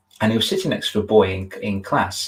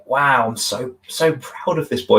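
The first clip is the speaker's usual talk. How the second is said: mock proud wow; 4 semitones higher, slower than usual. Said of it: "it was just like".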